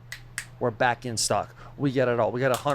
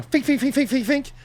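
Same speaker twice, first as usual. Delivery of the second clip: high, repeated "fink" sound effect; imitated rapid noise; 11 semitones higher than usual. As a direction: mimic a busy crew with high little noises. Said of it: "production crew is going".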